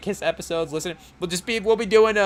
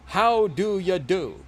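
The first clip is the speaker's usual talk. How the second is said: slow, halting actor drawl; deliberately butchered Walken-style delivery; Christopher Walken impression; 4 semitones higher, much slower than usual. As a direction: stretch it out with odd pauses like the famous actor. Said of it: "now we're gonna butcher a Christopher Walken".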